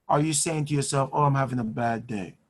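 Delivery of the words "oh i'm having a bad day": ordinary talk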